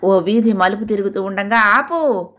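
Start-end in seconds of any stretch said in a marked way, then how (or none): 1.6-2.3 sudden shout. Acted. Wife shouting at husband to stop the scooter, sharp shout. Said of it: "గట్టిగా అరిచింది".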